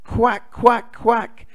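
high, loud little duckling quacks. Acted. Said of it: "says the ducklings".

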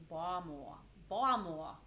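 mushy, swallowed Baltimore; exaggerated Baltimore accent; slurred Baltimore accent. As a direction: slur Baltimore down to two blurry syllables. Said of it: "sounds like we're having strokes".

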